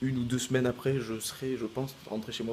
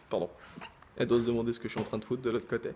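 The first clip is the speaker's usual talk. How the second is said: normal talking voice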